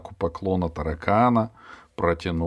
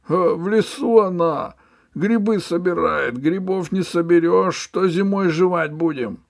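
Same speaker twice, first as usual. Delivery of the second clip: whining ferryman; 12 semitones higher, 7 dB louder than usual. Acted. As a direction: plead poverty. Ferryman lies. (высоко, жалобно и протяжно)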